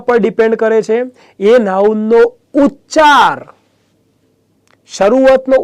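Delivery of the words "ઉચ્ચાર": ઉચ્ચાર louder in pitch, drawn out and falling; stressed key word. Real, not acted. Teacher telling students what the article depends on, stressed ઉચ્ચાર. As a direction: land firmly on ઉચ્ચાર, stretching it.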